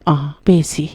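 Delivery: ordinary talk, nothing marked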